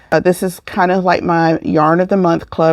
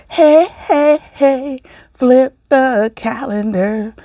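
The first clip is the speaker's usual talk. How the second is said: excited call; 5 semitones higher, slower than usual. Real, not acted. Excited calling to flip calendar.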